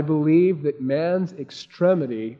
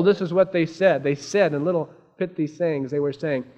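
usual speaking voice

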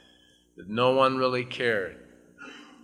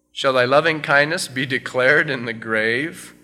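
loud, fervent reading of the psalm; solemn scripture reading; 2 semitones higher, 6 dB louder than usual. Acted. Pastor reading the psalm aloud to his church, fervent. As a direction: proclaim each verse to the congregation.

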